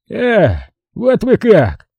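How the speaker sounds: slow, high-pitched threatening shout; menacing cry. A narrator's reading. Colonel enraged at being shaken by the lapels, menacing. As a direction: cry out slowly, voice jumping up. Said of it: "закричал он грозно".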